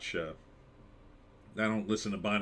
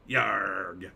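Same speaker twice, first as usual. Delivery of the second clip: pirate growl; 5 semitones lower than usual. Pretend. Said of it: "I like to talk in a pirate voice".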